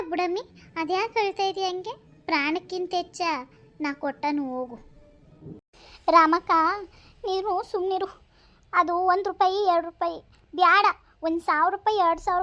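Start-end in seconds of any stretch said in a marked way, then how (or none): 6.1-6.5 sudden loud cry of her name; urgent call. Acted. Woman cuts in on her friend, loud urgent cry of her name. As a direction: cut her off with force.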